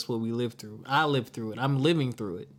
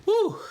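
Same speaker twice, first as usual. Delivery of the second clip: a long, high, relieved "whew" blown out; whew exhale; 5 dB louder than usual. Real, not acted. Vlogger reacting to [name]'s answer, exhaling whew. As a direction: let the breath out after the heavy clip. After an emotional clip.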